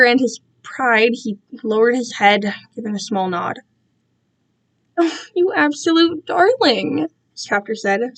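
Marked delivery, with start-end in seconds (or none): 5.0-7.1 slow, gushing delight. Acted. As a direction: coo it with sickly delight. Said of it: "sounding absolutely delighted".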